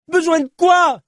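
high, exasperated yell; incredulous shout. Acted. Cyclist to journalist, baffled by his answer, incredulous shout. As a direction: throw the question back, yelling.

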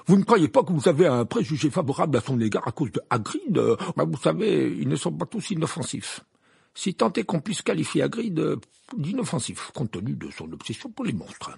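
angry. A narrator's reading. Fudge accusing Dumbledore of favouring Hagrid, angry. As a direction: argue heatedly, hesitating midway. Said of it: "s'exclama Fudge avec colère".

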